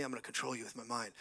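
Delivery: low, hushed, quick mock threat; leaning-in threat. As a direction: say it softly and low, right up close. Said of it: "he leans into me".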